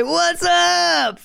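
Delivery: high, drawn-out "What's up?" like a buddy; cool casual greeting. Shown as a demonstration. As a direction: toss it off with swagger. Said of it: "You say".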